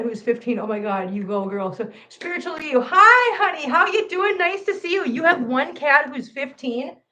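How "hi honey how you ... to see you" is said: 1 sweet greeting. Real, not acted. Greeting a cat on camera.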